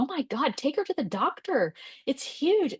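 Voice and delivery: high, alarmed father's cry; alarmed dad. Retold or imitated. Dad seeing the swollen arm, high and alarmed. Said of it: "He's like".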